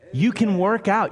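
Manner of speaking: usual speaking voice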